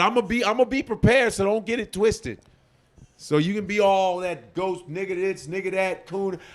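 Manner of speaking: [name] bravado. Real, not acted. Warning internet haters he is prepared, with aggressive bravado. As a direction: puff up and warn them off. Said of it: "You're channeling [name] up in here".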